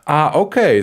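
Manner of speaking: normal talking voice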